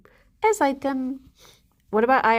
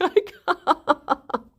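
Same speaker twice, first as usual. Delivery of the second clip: laughing plea; 7 semitones higher than usual. Retold or imitated. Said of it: "I'd be like".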